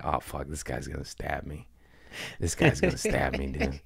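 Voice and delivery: low, hushed muttering; worried aside. Retold or imitated. Comedian fearing the heckler will stab him, hushed aside. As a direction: drop it under your breath, uneasy.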